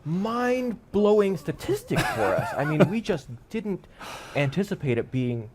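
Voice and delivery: hammed-up TED presenter with long pauses; mock TED speaker. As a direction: deliver silly statistics as a momentous revelation. Improvised mock TED Talk speech.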